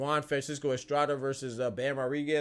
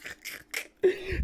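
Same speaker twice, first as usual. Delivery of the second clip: breathy laugh. Real, not acted. Laughing at own deep thoughts.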